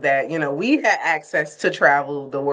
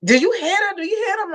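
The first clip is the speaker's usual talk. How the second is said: high-pitched imitation of a protester; mock agitated protester; faster than usual. Protester accosting a passerby minding their own business, mock agitated. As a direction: put on a high, pestering voice.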